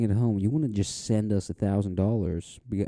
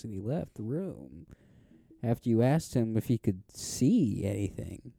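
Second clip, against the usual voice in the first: ordinary delivery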